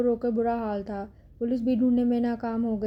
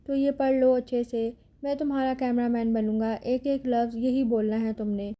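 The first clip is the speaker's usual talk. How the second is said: usual speaking voice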